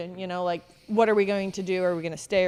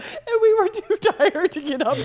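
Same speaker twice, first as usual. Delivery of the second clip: high, louder laughing voice; 11 semitones higher, 6 dB louder than usual. Real, not acted. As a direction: crack up at the memory. Laughing about dripping water.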